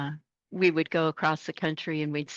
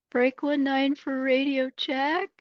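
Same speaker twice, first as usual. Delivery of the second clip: slow, higher trucker radio call-out; 9 semitones higher, slower than usual. Retold or imitated. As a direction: call it over the CB, drawn out. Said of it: "I'd be like".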